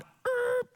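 high-pitched "er" halting noise; imitated screech. Shown as a demonstration. Imitates stopping short mid-sentence.

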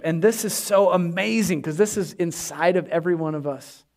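normal talking voice

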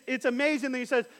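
ordinary talk, nothing marked

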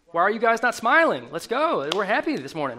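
loud, high, forced pep; rally them with put-on happiness; mock cheerleading. Preacher mimicking Christians who must be happy, mock cheerleading.

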